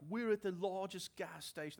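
a hint of an Aussie tourist, amazed; Australian accent. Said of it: "That's Australian".

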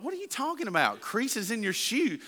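can't believe what he hears; incredulous dad. Retold or imitated. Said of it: "I'm like".

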